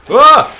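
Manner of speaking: high loud startled cry; startled yell. Real, not acted. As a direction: yelp at a sudden fright. Sudden enemy in the game.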